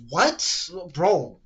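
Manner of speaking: disbelief. Retold or imitated. Benched player's disbelief.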